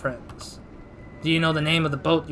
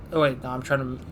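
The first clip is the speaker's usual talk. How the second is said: usual speaking voice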